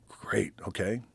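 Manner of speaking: whispered, unvoiced reply; a whispered, nervous okay. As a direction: breathe it out under your breath, uneasy. Nervous reply to Vince's warning.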